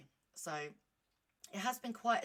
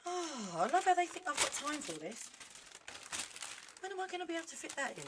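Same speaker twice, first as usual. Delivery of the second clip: sarcastic; 9 semitones higher, 4 dB louder than usual. Real, not acted. Sarcastic rant at leaflet.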